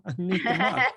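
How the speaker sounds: mock official voice breaking into laughter; laughing mock announcement. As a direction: finish the pompous line while cracking up. Finishing the quote, laughing.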